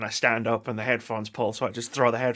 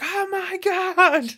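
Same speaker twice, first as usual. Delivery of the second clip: high, loud, drawn-out exclamation; amazed outburst; 7 dB louder, much slower than usual. Retold or imitated. Podcaster showing his version of reveal hype, amazed outburst. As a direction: stretch it out in high-pitched awe. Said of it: "that's my version of".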